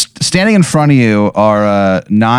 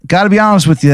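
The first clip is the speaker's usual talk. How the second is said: doctor voice; 9 semitones higher, faster than usual. Acted. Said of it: "and he goes".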